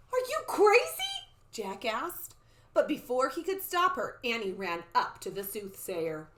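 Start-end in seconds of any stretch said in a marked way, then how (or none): 0.1-1.3 very high, loud disbelief; squeaky, astonished question; incredulous outburst. Acted. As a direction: exclaim it at a reckless sister. Jack incredulous at Annie.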